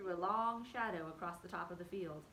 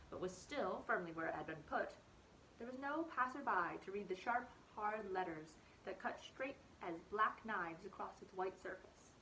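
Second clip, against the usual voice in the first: ordinary delivery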